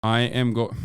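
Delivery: ordinary talk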